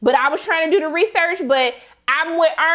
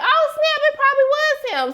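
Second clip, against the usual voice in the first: much higher, excited Oh, snap; sudden realization; 11 semitones higher than usual. Retold or imitated. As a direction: pitch jumps up as it clicks. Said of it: "I was like".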